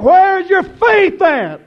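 high, loud, slow preacher's shout; full-force preaching. Real, not acted. Preacher shouting Jesus' rebuke to the church. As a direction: bellow the rebuke at the hall.

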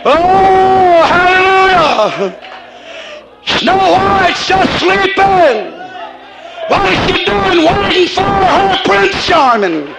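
high, slow ecstatic preacher's cry; fiery preaching. Acted. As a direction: stretch each word in rapture. Preaches at full force.

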